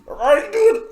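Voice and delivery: badly faked Australian accent, high and loud; awful high Australian accent attempt; attempted raspy Australian accent. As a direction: squawk out a failed Australian accent. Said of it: "with that accent?".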